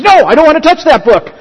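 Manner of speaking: quick recoil, acting out an offended woman; indignant refusal. Woman on a train refusing the offered book, indignant.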